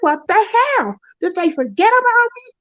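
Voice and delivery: high, outraged question; indignant disbelief. Retold or imitated. Nobody called by five, high outraged disbelief. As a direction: squeal it like you've been forgotten.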